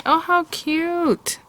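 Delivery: high, slow, gushing coo; delighted squeal. Real, not acted. Crafter gushing over her embossed card, high delighted coo. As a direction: melt over how cute it is.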